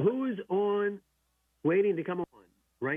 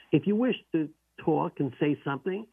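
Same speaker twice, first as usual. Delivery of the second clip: ordinary delivery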